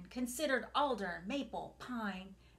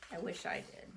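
whispering; 4 semitones lower, 5 dB quieter, faster than usual. Real, not acted. Hushed reply.

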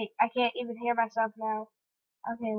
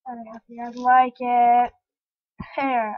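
ordinary talk, nothing marked

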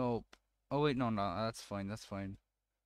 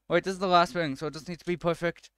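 ordinary talk, nothing marked

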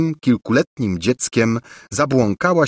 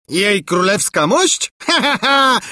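mocking laugh; 11 semitones higher than usual. A narrator's reading. King mocking his wife, laughing. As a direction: laugh off the queen. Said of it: "zaśmiał się monarcha".

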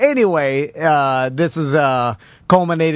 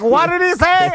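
high-pitched mock bewilderment; high, incredulous query; baffled retold question; 11 semitones higher than usual. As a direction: imitate puzzled grandparents, voice way up.

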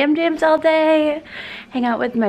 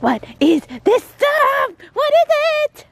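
high, slow puzzled voice for the dog; dog's voice; 13 semitones higher, 4 dB louder, slower than usual. Pretend. Owner voicing puppy amazed by snow, high. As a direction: voice the puppy's wonder. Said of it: "She's like".

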